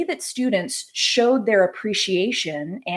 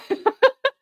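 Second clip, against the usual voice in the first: short high giggle; high laugh. Real, not acted. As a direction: giggle at yourself. Laughs after dad quote.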